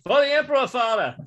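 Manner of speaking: loud, high war cry mid-attack; high-pitched zealot's shout; screamed battle cry. Acted. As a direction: cry it out high as you lunge. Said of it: "Once again, saying".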